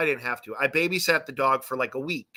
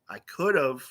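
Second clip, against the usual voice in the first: matter-of-fact concession; 2 semitones lower, 4 dB quieter than usual. Retold or imitated. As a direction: drop it down and concede drily. Same comeback said again, lower.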